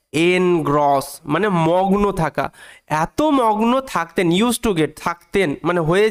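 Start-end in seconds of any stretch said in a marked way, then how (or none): 0.1-1.2 "এনগ্রস" slowly as en... gross; broken into syllables. Shown as a demonstration. Teacher concluding the pronunciation rule, "এনগ্রস" said as en... gross. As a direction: sound out "এনগ্রস" in two clear pieces. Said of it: "ওই কারণে এটার উচ্চারণ হচ্ছে".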